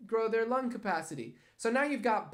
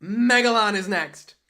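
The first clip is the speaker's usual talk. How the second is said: loud announcement; 2 semitones higher, 13 dB louder, slower than usual. Pretend. Announcing next drawing.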